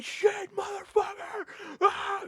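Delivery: high yelled curses; retold screaming. Driver screaming curses at eighty on the highway, high shout. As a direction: scream it out in a panic rage. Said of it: "Just screaming".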